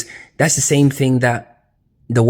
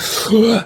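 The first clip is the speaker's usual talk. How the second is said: waking gasp; 12 semitones higher, 4 dB louder than usual. Acted. Adam waking up gasping.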